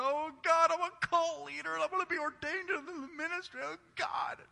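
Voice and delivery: high wailing cry to God; mock anguished wail. Pastor retells crying to God, in a high wail. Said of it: "crying out to God and went".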